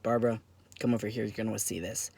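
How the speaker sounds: low, quick, soft call to a colleague; discreet summons. Acted. TSA agent calling a colleague for backup, low and hushed. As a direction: keep it down, urgent and understated.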